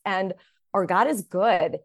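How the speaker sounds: ordinary talk, nothing marked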